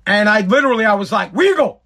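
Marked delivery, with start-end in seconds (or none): 1.3-1.8 calling out. Retold or imitated. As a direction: shout "Weagle!" across the gas station, higher. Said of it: "I yelled at him".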